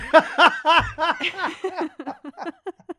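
big high-pitched burst of laughter; real loud cackling laugh, rising. Real, not acted. Joke about lending shorts.

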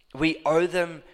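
ordinary delivery

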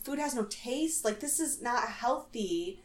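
quiet, questioning disbelief; lightly imitated onlooker's worry; Nastasia. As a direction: softly echo it as a concerned question. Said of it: "She's like".

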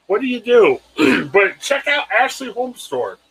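ordinary talk, nothing marked

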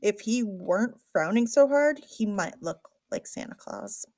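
ordinary delivery, nothing marked

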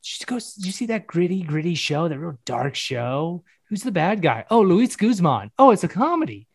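lightly acted viewer, rising to a surprised "oh"; imagined viewer. Imagined viewer asking about the show's bad guy, lightly acted surprise. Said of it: "if somebody's all like".

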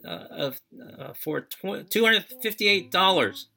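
high, loud imitating yell; retold yelling. Police officer yelling at a jaywalker, loud and high. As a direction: shout it like an angry traffic cop. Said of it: "yells at me".

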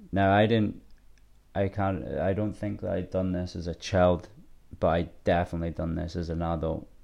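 normal talking voice

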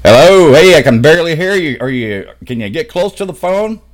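calling loudly into a bad line. Real, not acted. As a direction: raise your voice to be heard. Host straining on bad line.

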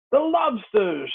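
high, drawn-out call; narrated shout. A narrator's reading. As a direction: shout it high and slow, as a storyteller would. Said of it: "shouted the Griffin".

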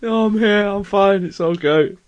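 high, loud reassurance through laughter; dying with laughter. Real, not acted. Co-host recovering from a laughing fit, dying with laughter. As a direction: insist you're fine while cracking up. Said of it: "you're dying with laughter".

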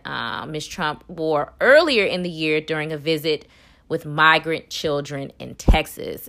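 ordinary talk

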